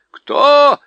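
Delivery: dwarf annoyed at the shouting owl; grumpy deaf dwarf. A narrator's reading. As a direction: fuss like an affronted deaf dwarf.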